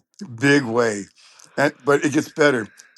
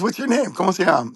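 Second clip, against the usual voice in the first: imitating a friendly higher-pitched question; an eager new acquaintance, voice pitched up; Johnny Adino; 7 semitones higher, faster than usual. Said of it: "He goes".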